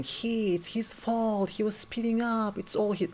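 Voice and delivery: high-pitched whiny accusation, imitated; mock screaming. Panicked friends blaming the injured man, mock screaming. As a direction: wail it high like a mock tantrum. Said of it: "they start screaming".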